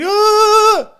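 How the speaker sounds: high, loud imitated cheering yelp; a hammed-up cry of joy; mock cheer. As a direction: squeal like the crowd cheering the sign. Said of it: "and you're cheering".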